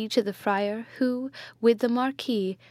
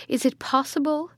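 higher-pitched cry of disbelief; distraught, raised-pitch questioning; violent exclamation; 5 semitones higher than usual. A narrator's reading. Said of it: "The violence of his exclamations".

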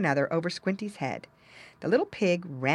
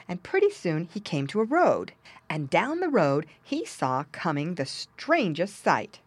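ordinary delivery

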